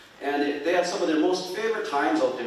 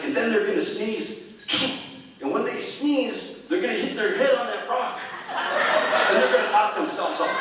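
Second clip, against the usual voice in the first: high, loud build to the punchline; animated grandpa selling a tall tale; excited storytelling; 10 semitones higher, 6 dB louder than usual. Retold or imitated. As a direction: play up the comic climax, pitched high. Grandpa's comic hunting tale climax.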